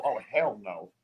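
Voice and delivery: a hint of a grossed-out girl; imagined girl's reaction. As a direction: play the girl recoiling, low and quiet. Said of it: "she's gonna go".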